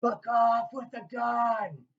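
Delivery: high, slow put-on yell; mock background scream. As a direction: belt it like a stranger offscreen. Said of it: "you hear this guy scream in the background".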